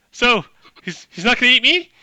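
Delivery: high, loud panicky question; screaming human. Acted. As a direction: ask it as a terrified captive, voice shooting up. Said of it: "the person screaming is like".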